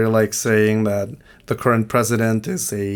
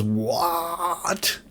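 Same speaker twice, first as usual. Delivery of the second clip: incredulous surprise; 5 semitones higher, much slower than usual. Retold or imitated. Lady hears about the long walk.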